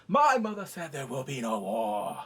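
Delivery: loud start, sinking pitch; mock princely declaration. Son forbidding war before the lords, pompous declaration. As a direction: proclaim it with put-on authority. Mock Jace laying down law.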